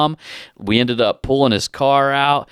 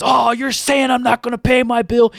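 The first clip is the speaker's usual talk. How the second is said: much higher, an outraged hammed-up retort; mock indignant customer; 9 semitones higher than usual. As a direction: imitate the offended customer taking the bait. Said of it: "starts mother effing me again".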